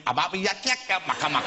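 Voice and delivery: garbled mock-Klingon babble; mocking gibberish imitation. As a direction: mimic a badly mangled accent as nonsense syllables. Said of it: "that's Klingon you're speaking there".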